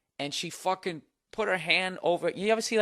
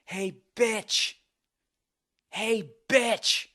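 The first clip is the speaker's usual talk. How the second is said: higher mock yell of an angry woman; imitating woman yelling; 8 semitones higher, much slower than usual. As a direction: call her out like a drunk angry girl at the bar. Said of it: "this woman yelling".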